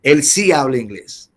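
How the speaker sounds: ordinary talk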